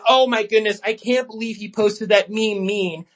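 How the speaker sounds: sarcastic imitation of a pearl-clutching user; mock complainer. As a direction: mimic a fussy online critic. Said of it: "just, like, complaining".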